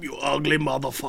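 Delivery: lightly voiced Austrian action hero; a hint of action-film strongman; Arnold Schwarzenegger. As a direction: toss out an insult in a mock Austrian accent. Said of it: "Arnold Schwarzenegger, how did you".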